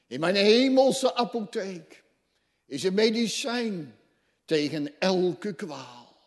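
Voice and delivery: high, loud proclaiming, slowing at the end; preaching at full force. Acted. Preacher voicing God's promise to the church, high and loud. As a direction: lift the promise up to the rafters.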